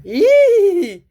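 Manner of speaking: long, piercing high squeal. Retold or imitated. Character insists they are terrifying, high loud squeal. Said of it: "No, I'm terrifying".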